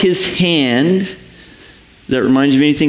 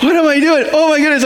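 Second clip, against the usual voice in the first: much higher alarmed cry; panicked Peter; 10 semitones higher, much faster than usual. Acted. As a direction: play Peter suddenly scared.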